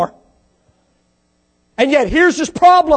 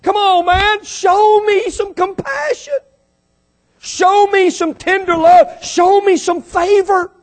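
slow, higher pleading plea for compassion; pleading rich man; 6 semitones higher, slower than usual. Retold or imitated. Preacher voicing the rich man begging for compassion. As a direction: beg slowly for mercy, voice raised. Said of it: "He says".

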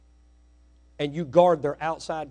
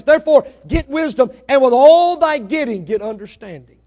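rising louder and higher, urging them to go get it; building to full-force preaching; 8 semitones higher, 9 dB louder than usual. Acted. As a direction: drive the call for wisdom to a climax.

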